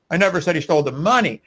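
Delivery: "money" punched at the end of the sentence; stress on MONEY. Shown as a demonstration. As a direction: save the weight for "money". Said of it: "By just emphasizing different words".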